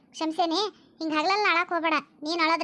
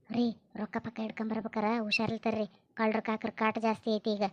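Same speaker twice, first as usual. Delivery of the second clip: hushed aside; 6 semitones lower, 6 dB quieter than usual. Acted. Wife's hushed aside after husband leaves.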